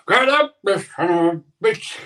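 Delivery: gruff coach bellowing high and loud; impersonating Mickey, shouting; Rocky's trainer yelling. Retold or imitated. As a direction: yell it like a boxing trainer in the corner. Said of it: "can you see Rocky's trainer yelling".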